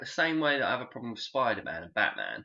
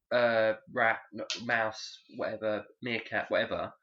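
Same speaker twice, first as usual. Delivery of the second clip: ordinary talk, nothing marked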